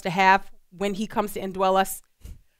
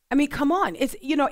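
ordinary delivery

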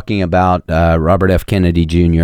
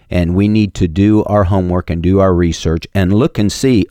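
usual speaking voice

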